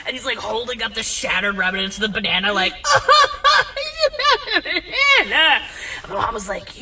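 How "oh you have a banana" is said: loud squealing wail, stretched out; mock bawling. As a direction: wail like a heartbroken drunk, way up high. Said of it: "he just starts bawling".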